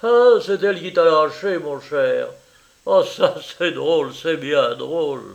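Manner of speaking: loud, crowing tease; triumphant. A narrator's reading. Paul mocks his jilted friend at dinner, triumphant. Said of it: "Paul triomphait".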